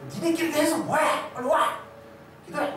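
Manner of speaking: high mock yell, imitating a pastor; shouting pastor. Pastor scolding young adults at their Bible study, shouting. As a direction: act out a pastor yelling orders. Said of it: "소리치고 막".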